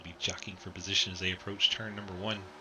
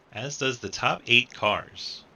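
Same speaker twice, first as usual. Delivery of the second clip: normal talking voice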